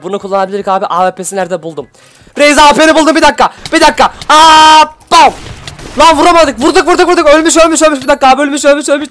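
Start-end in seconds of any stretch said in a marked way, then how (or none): 2.4-4.1 high excited rush of words; hyped up, calling out mid-fight; breathless gamer excitement; excited gaming yell. Real, not acted. 4.3-5.3 loud shouted gunshot noise; imitated gunshot; shouted bam. Real, not acted. Shooting sound effect. 6.0-9.1 high, loud frantic repeating; hyped kill call; frantic excitement. Real, not acted.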